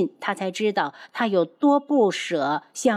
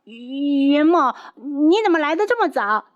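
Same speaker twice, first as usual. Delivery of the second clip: startled; 6 semitones higher, 4 dB louder than usual. Acted. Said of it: "贺兰溪僵住半天才道".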